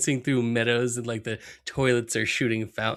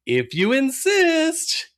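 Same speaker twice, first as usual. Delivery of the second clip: much higher, slow sly Bart; 13 semitones higher, 3 dB louder, much slower than usual. Retold or imitated. Cohost voices Bart giving in, sly high voice. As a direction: say it with sly mock politeness, high. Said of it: "he's like".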